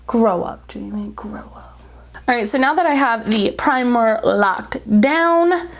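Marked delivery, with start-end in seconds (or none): 0.1-1.8 hushed scolding whisper. Real, not acted. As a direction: whisper it under breath.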